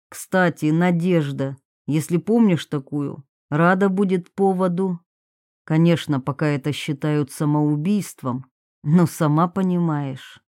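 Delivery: low, controlled man's voice; man making veiled threat. Acted. Dalmatov hints at police to Varvara, low and controlled.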